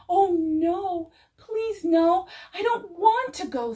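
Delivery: high, crying plea; frightened pleading. A narrator's reading. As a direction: beg in tearful panic. Seed fears being planted.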